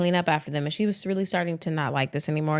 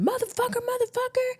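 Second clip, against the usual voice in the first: much higher shrieked curse; mock screaming; 3 dB quieter than usual. Neighbors hear Yvette screaming at Theodore, high shriek. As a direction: shriek it up high like a furious woman. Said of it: "hear yelling and screaming".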